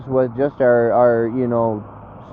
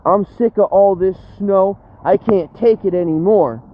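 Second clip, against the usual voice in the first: higher, even whinier replay of the complaint; milk the whine harder the second time; exaggerated whiny repeat; 6 semitones higher than usual. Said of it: "What did you say?".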